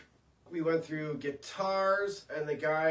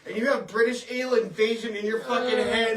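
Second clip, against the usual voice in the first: louder, higher mock incredulity; amused disbelief; 4 semitones higher, 6 dB louder than usual. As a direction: play the astonished radio host. Acted hosts' disbelief at the caller.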